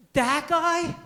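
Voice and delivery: loud, high, appalled "That guy?"; shouted disbelief; shocked disbelief. Retold or imitated. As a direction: friends aghast at who she's dating. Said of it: "afterwards they go".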